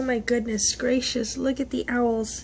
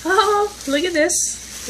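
drawn-out delighted oh; thrilled gasp of a look; excited; 8 semitones higher, 5 dB louder, slower than usual. Real, not acted. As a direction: savor the surprise, high and slow. Excited unboxing gift.

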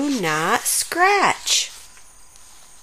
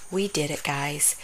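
much softer, lower, drained; worn-out sigh; 7 semitones lower, 11 dB quieter than usual. Acted. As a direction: barely get the words out. Exhausted after resisting.

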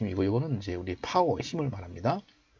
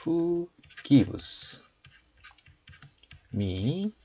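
word by word, very slowly, raised voice; slow model reading; 5 semitones higher, much slower than usual. Shown as a demonstration. Teacher introducing the verse's last part, slow model reading. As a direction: spell out the phrase slowly for learners.

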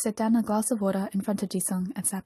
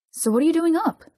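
high, louder insistent question; demanding; 8 semitones higher, 5 dB louder than usual. A narrator's reading. Jisung presses Dong-hyuk on being awake, demanding. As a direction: demand an answer, pitch climbing sharply. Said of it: "Jisung demanded".

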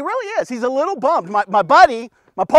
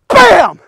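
loud, higher bam; sound effect bam; 6 semitones higher, 10 dB louder than usual. Shown as a demonstration. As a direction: crack it like a whip. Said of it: "Elisha grabs that cloak".

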